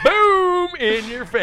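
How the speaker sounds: slow high shouted boom; booming yell. Pretend. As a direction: roar it out, drawn out. Shouted punchline.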